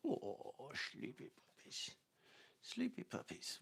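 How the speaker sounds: hushed whisper so as not to wake them; whispering to puppies. Real, not acted. Owner whispering over sleeping puppies, soft hush. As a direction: breathe the words to the sleeping puppies.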